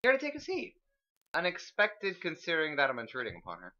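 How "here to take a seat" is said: high-pitched, well-mannered character voice; refined, high voice; formal lady. Acted. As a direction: offer it with polite formality. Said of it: "the formality of hers".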